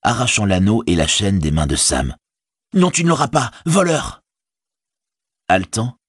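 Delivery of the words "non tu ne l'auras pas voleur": high, accusing cry; hostile outburst. A narrator's reading. Hobbit snatching the ring from his servant, high and hostile. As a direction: accuse him, pitched right up. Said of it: "il le dévisagea avec hostilité".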